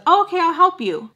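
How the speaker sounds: high, loud imitation; eager-helper mimicry. Sam offers to help Joe, high loud mimicry. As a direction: mimic a volunteer, pitched well up.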